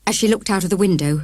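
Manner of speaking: usual speaking voice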